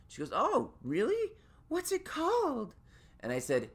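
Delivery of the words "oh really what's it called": high, bright-eyed stylist asking; chirpy high salon voice; imitating the stylist, much higher; stylist's voice. Stylist voiced, asks about podcast.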